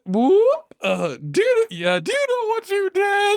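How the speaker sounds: very high, eerie menace; high creepy monster taunt; creepy creature voice. Acted. Big Nose taunting again.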